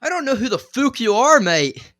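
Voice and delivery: high, loud hammed-up Irish accent; performed Irish accent on request. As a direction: belt it out like a cocky Irish fighter. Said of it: "Do it in your best Irish accent".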